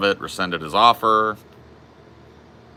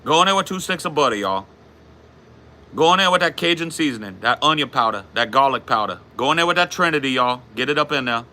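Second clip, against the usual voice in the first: high-pitched Cajun cooking host; put-on Louisiana Cajun accent, pitched well up; 5 semitones higher than usual. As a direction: play a Cajun cook announcing the recipe. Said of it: "How's your Cajun accent so good?".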